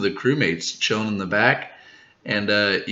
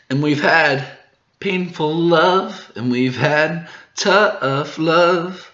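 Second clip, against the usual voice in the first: slow, higher, suave spy delivery; James Bond-ish line; 7 semitones higher, much slower than usual. Acted. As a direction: drawl it out like a film spy. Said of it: "I thought of James Bond".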